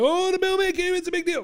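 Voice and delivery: high, eager voice; thrilled the mail came; excited. Retold or imitated. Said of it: "you were like".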